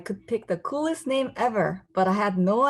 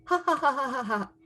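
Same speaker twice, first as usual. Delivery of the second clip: mocking laughter; 6 semitones higher, faster than usual. Classmates mock her name.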